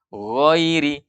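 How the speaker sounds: GHAIRI sounded almost as GHOIRI; tebal seperti O. Shown as a demonstration. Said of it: "seakan-akan suaranya o". (GHAIRI dibaca tebal seakan-akan O)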